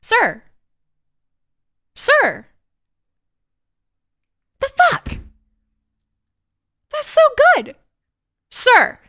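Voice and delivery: high, loud disbelief; incredulous. Real, not acted. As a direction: react in shocked disbelief. Reacting to a tweet.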